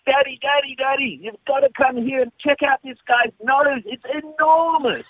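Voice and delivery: put-on high little-boy voice calling out; child calling across. Dad imitating his son calling across the park. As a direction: mimic a five-year-old shouting to dad. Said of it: "called across".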